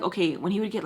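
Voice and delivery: ordinary delivery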